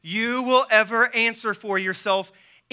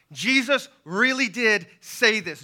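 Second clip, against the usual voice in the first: normal talking voice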